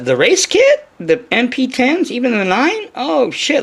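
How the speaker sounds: high, loud, hammed-up excitement over the race kit; mock amazement. Imitating amazed buyer at race kit.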